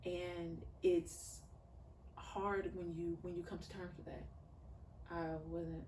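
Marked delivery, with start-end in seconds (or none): none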